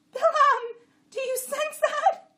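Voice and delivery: very high, loud donkey alarm; alarmed bray. A narrator's reading. Jenny warning her rider, braying very high. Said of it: "Chamra brayed".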